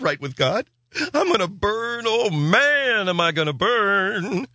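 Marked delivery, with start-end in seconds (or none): 1.1-4.5 high, slow hammed-up dread; mock terror. Sinner fears burning, high slow mock wail. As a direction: wail it in comic fear of hell.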